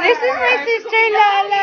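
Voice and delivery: high, loud, delighted introduction; excited introduction. Real, not acted. Vlogger presenting her sister to viewers, excited.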